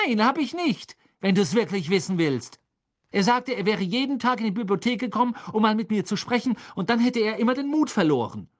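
high, hurried, defensive rush; speaking very fast. A narrator's reading. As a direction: rattle out the embarrassed explanation. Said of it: "Hermine hatte sehr schnell gesprochen".

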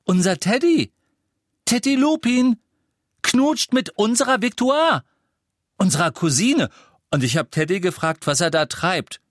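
put-on very high, loud, insistent boy; incredulous excitement. A narrator's reading. Boy repeats gossip to unimpressed parents, loudly excited. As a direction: hammer the news home, scandalised.

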